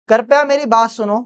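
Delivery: usual speaking voice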